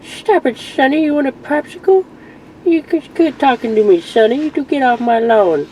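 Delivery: put-on Herbert old man voice. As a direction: wheeze it out like a cranky old man. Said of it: "He was using the old man voice".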